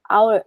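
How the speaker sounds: hour starting on the vowel, no H; silent H. Shown as a demonstration. Teacher giving the second example to students, hour with silent H. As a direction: drop the H in hour completely. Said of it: "สองคำเนี้ยไม่ต้องออกเสียงตัว H".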